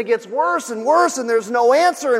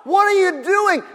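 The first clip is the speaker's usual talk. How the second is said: frustrated plea; 7 semitones higher than usual. Retold or imitated. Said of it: "I'm thinking".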